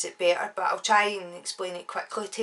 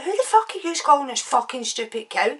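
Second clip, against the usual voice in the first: higher-pitched confrontational demand; indignant retort; 5 semitones higher, 4 dB louder than usual. Retold or imitated. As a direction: re-enact squaring up to him. Acted retort in a bar quarrel.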